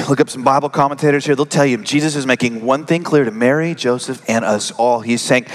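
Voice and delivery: ordinary talk, nothing marked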